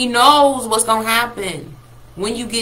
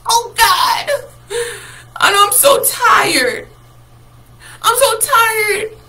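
high, loud, slow mock wail; mock whining crier; 12 semitones higher, 5 dB louder, much slower than usual. Preacher mocking churchgoers with a loud, crying whine. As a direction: whine like a worn-out believer.

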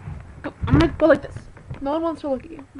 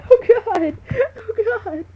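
higher, louder laughing wail; laughing cry; 8 semitones higher, 11 dB louder, slower than usual. Real, not acted. Owner laugh-wails while the dog mauls her hair.

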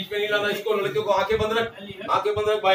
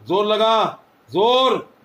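louder, higher push; loud urging shout; 4 semitones higher, 6 dB louder, much slower than usual. Real, not acted. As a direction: yell at her to push harder. Urging loudly during exorcism.

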